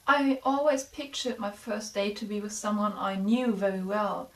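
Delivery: calm, even storytelling voice; story narration. A narrator's reading. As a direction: read it like a gentle story. Reads the Christmas story aloud.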